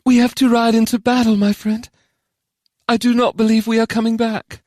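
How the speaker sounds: high-pitched, solemn warning to a friend; resigned Charon. Acted. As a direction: announce it high, accepting the worst.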